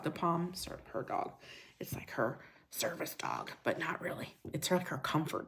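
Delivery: whispered aside; hushed sidenote to the chat. Real, not acted. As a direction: drop to a soft whisper, confiding. Aside about daughter's dog.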